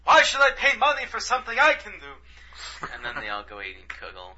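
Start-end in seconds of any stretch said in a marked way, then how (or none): 0.0-2.9 high, loud put-on old man bickering. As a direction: ham up a kvetching old neighbour.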